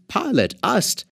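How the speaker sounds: plain reading voice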